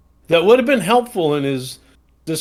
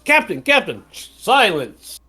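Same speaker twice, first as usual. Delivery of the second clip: pretend ship dialogue; 4 semitones higher than usual. Drummer and captain exchange.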